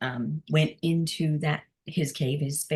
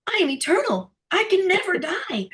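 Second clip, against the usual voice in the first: much higher, louder proclamation; elated declaration; 11 semitones higher, 3 dB louder than usual. Retold or imitated. Waking up and telling the trainers, elated declaration. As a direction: announce it as a revelation.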